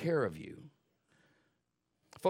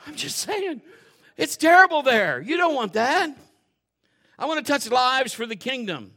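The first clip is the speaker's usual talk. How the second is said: louder, higher fiery preaching; full-force preaching; 8 semitones higher, 9 dB louder than usual. Acted. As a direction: bring it home to the congregation.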